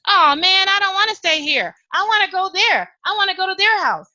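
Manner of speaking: put-on high, pleading child's whine; whiny little girl. Little girl whining to her mom about the sleepover. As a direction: beg like a kid who's been told no.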